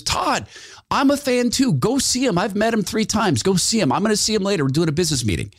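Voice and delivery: high put-on voice urging him on; imitating Dave. Dave urging Todd to go meet Glenn, in a high put-on voice. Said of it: "He goes".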